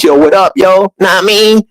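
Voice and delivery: imitating teammates' slang, hammed up; bouncy high mock street talk. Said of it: "So I would just talk like them".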